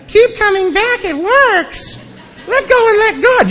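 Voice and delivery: very high, sing-song mock chirp; mocking cheery slogans. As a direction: parrot the slogans in a silly high voice.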